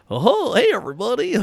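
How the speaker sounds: over-the-top radio greeting, high and loud; wacky morning host. Acted. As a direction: burst in like a zany morning DJ. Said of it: "the wacky morning".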